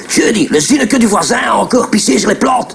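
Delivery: sing-song Brussels accent demo. Shown as a demonstration. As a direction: Brussels accent, lightly sung. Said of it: "Le Bruxellois, il parle comme ça".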